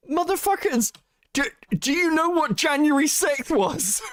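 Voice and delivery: high, exasperated shout at the crowd; mock outraged yell. Streamer yelling at chat, mock outraged shout. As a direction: yell it like you can't believe them.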